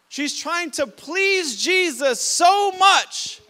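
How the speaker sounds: preaching shout. Real, not acted.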